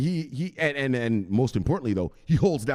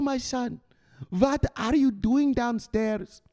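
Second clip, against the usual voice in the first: put-on much higher, slow nagging European mother accent; European mama accent; 14 semitones higher than usual. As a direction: scold your son in a European mama accent. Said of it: "so she has an accent".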